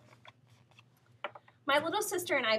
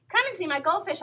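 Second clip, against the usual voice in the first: eager; 8 semitones higher, 4 dB louder than usual. A narrator's reading. Boy distracts his mother.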